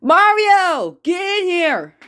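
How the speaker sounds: loud call from another room. Acted. Brother yelling for Mario to come in, loud call. Luigi calling Mario from afar.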